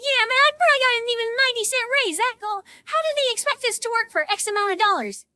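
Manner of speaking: high, hurried mock griping; imitated indignant moaning; grumpy complaint. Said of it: "he's all grumpy too".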